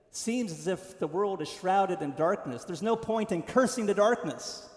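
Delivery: normal talking voice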